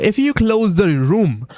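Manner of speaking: ordinary talk, nothing marked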